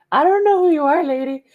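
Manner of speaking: voicing the horse. The horse speaks to the rider who mistook it for her own, in a high put-on voice. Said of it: "He was kinda like".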